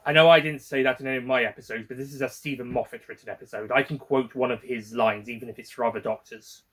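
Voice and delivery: ordinary delivery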